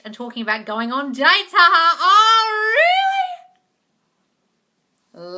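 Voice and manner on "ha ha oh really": very loud, high, drawn-out glee; big swooping whoop of interest; gleeful reaction. Real, not acted. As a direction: crow with delight at juicy news. Reacts to a viewer's dating news.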